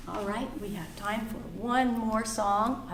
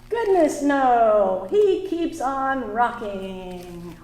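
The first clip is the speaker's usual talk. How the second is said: put-on reading to children; 3 semitones higher, 4 dB louder than usual. Teacher reading a picture book aloud to the class.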